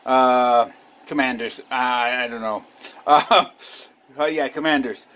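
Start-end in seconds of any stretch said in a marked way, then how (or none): none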